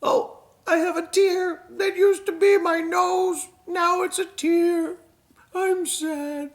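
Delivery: high, slow little puppet voice; sad puppet. Acted. Sad puppet shows off its tear, high slow pouty voice. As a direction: pout it out like a sad toy.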